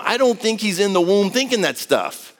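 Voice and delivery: ordinary talk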